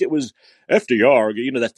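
ordinary talk, nothing marked

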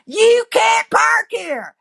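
high, stretched mock-angry bark; exaggerated high-pitched indignation; irritated. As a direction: imitate an irritated man, straining high on every word. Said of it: "He's so irritated".

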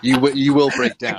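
laughing through the words. Real, not acted. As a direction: chuckle it out. Joking about forced cry playlist.

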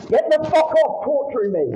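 loud, high, enraged yell; furious shout. Real, not acted. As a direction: bellow it in real fury. Shouting at people holding him.

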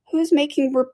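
high, gloating retort; high-voiced point-scoring; triumphant. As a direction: catch them out, pitched high. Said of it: "said the Hatter triumphantly".

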